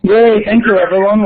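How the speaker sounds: bright, loud happy greeting; excited yay. Real, not acted. Co-host greeting the webinar audience with a loud excited cheer. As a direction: cheer them in with a big yay.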